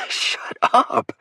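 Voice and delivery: breathy laughing hush. A narrator's reading. Will hushing Lake while cracking up, laughing. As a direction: say it through laughter. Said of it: "I say, laughing".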